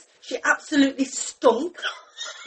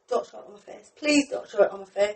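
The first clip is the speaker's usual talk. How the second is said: hushed and lower, thinking to herself; quiet inner voice; 6 semitones lower, 8 dB quieter than usual. Retold or imitated. Worrying at work that it shows, hushed inner voice. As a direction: lower and softer, like thinking aloud. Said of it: "I'm thinking it in my head".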